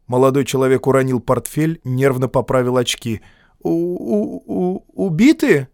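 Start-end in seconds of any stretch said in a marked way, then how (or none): 3.6-5.7 high, halting shocked stammer. A narrator's reading. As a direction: stutter out the word in fright.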